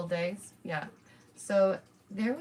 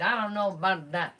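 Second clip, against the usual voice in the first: doubtful, dismissive put-on voice, louder; skeptical mock quote; 6 dB louder than usual. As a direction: shrug it off in a mocking voice. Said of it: "And the American Media Society said".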